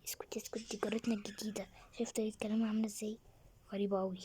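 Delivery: hushed urgent whisper, the last line rushed. Acted. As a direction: whisper it fast so the neighbour can't hear. Hushing spouse about new neighbour. (همس سريع خائف أن تسمعه الجارة)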